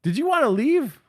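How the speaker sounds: lightly voiced flirt, mostly high; flirty come-on. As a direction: sweet-talk the big guy. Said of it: "I'm hitting on this guy".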